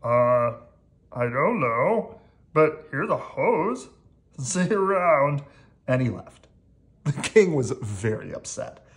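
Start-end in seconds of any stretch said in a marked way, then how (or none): 0.0-5.4 drawn-out, rising-and-falling character voice; louder, stretched-out words with a shrug; lightly put-on storybook character; hapless hose-bringer.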